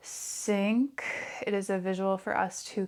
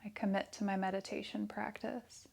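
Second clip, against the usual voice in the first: soft, hushed meditation voice with long pauses; guided meditation; 6 dB quieter than usual. Real, not acted. Yoga teacher leading breath awareness and affirmations, soft meditation voice. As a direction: guide them softly into stillness, leaving space after each phrase.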